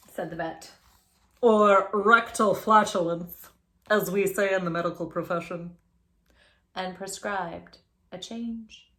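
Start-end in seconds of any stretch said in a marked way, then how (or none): 1.4-5.8 pompous vet. Acted. Vet voiced in story.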